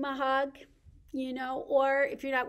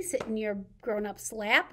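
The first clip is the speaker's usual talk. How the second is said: ordinary talk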